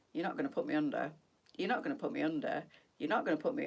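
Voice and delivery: quick, low, softly insistent inner voice; mock stubborn refusal. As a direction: imitate quietly digging your heels in. Mimics stubborn inner voice before hypnosis.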